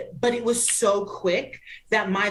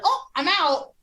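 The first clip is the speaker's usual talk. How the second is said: high, small teasing imitation; mock timid bail-out; 10 semitones higher than usual. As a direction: squeak it like someone fleeing shyly. Imitating Marty's shy quick exit.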